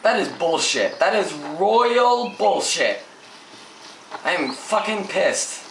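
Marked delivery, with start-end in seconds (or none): 1.0-3.0 slow, louder, leaning hard on royal; slow emphatic rant. Real, not acted. Gamer ranting about the game, slow emphatic disgust. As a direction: drag it out to drive it home.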